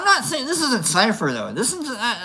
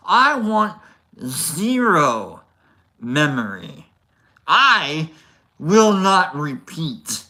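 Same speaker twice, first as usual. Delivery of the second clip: each word punched out with pauses; emphatic; 4 dB louder than usual. Real, not acted. A vlogger vowing never to taste pineapple, slow and emphatic. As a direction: hammer every word, slow and loud. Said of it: "That's how much I want it out".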